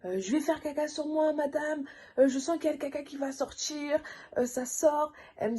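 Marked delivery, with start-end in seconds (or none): 0.2-4.0 high embarrassed pleading; a touch of distressed pleading; mild panic. Retold or imitated. Mother in labour telling the midwife, higher and mildly panicked. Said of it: "à crier".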